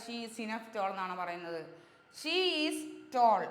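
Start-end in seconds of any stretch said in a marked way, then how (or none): 2.2-3.4 slow model sentence. Shown as a demonstration. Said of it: "tall എന്ന് പറയുന്ന word എന്താണ്?".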